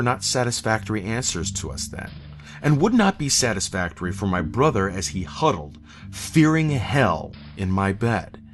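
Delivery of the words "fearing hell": ordinary delivery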